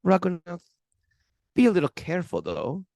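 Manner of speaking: imitated German swami's voice; German accent. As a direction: put on a German accent, gently cautioning. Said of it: "he says in that German accent".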